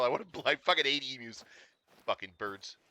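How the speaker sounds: usual speaking voice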